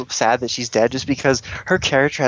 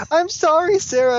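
much higher, mock-girlish apology. A fan voicing a character's apology, mock high. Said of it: "she's like".

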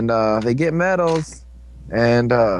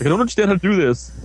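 higher, put-on exasperated whine; acted, pitched-up grumbling at a game; frustrated gamer; 4 semitones higher than usual. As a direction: swear at the game in mock frustration.

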